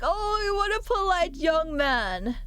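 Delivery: put-on high, drawn-out old-lady coo; put-on grandma. Grandma praising a young man, high affected coo. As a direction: slowly gush like an approving granny. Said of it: "Not in that accent".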